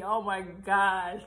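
loud slow exclamation. Real, not acted. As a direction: stretch out the oh in disbelief. Recognizing her old self, exclaiming.

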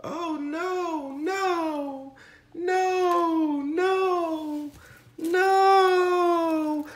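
very high, rising to a cry; overjoyed shriek. Real, not acted. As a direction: squeal no over and over, near tears. Overwhelmed by the gift.

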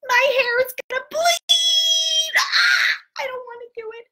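high, wailing, hammed-up crying; fake crying. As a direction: whine like a kid refusing a haircut. Refusing the haircut.

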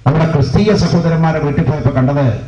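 ordinary talk, nothing marked

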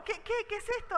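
high and loud, stammering in alarm; panicked question. Retold or imitated. Asking the trucker about the bite, alarmed and loud. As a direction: blurt it out in a panic. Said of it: "Entonces yo como".

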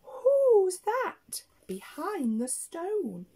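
high, slow, drawn-out wondering; bird friend's question. Acted. Bird friend asking what is behind the stone, high sing-song wonder. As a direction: swoop up high and stretch the question out. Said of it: "our lovely bird friend says".

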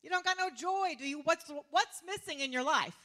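sarcastic jabbing questions; sarcastic Jesus. Retold or imitated. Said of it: "it's so sarcastic to me".

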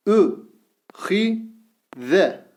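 Vü said with thin Ü, each syllable drawn out; syllable drill with thin vowel. Shown as a demonstration. Teacher reads the thin-vowel syllables to students, slowly. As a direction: read out each syllable apart. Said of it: "Ü sesiyle okuyoruz".

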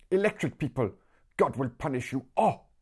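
high, hammed-up street shout; shouting woman. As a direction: call out a curse at the whole street. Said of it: "she heard a woman shout".

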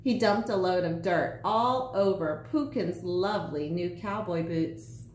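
ordinary delivery, nothing marked

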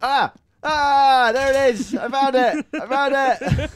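excited shout. Real, not acted. Finds hidden item, shouting.

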